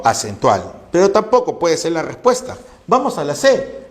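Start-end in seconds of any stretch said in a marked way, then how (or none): none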